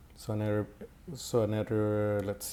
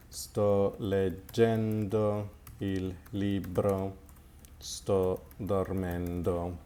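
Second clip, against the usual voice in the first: each word laid out slowly for learners; slow, clear model phrases; 2 semitones lower, much slower than usual. Shown as a demonstration. Teacher modelling phrases slowly for the class. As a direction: spell out the Italian phrases slowly. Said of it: "teacher models progressive forms".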